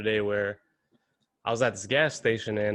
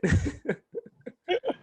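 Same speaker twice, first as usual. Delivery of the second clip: high laugh. Real, not acted. Laughing at his favorite joke, high burst. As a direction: crack up hard. Cracking up at own story.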